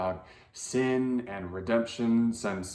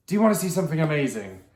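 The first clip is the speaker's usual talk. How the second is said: higher, louder, fast eager tease; excited coaxing; 6 semitones higher, 4 dB louder, much faster than usual. Retold or imitated. Narrator distracts the crying girl with a surprise, fast eager coaxing. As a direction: hook her with quick, bright excitement.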